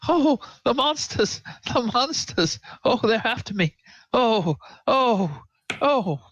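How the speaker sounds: high, wailing frightened character; acted panic. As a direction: wail it like something is chasing you. NPC flees imagined monsters.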